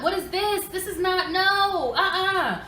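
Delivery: high, loud mock-outraged mother; scolding interrogation. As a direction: fire indignant questions at the kids. Mother retells grilling kids over homework.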